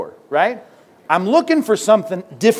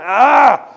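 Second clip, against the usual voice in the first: loud, high exasperated "Ah!"; a loud fed-up yelp; exasperated cry; 6 semitones higher, 8 dB louder than usual. Retold or imitated. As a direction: burst out in mock frustration. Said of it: "God is rolling his eyes at you".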